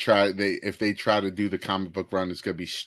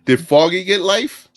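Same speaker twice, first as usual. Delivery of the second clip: raised, pointed demand about Foggy; indignant louder question; 8 semitones higher, 8 dB louder than usual. Retold or imitated. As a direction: push the question out louder, hitting get. Frank quoted confronting Matt.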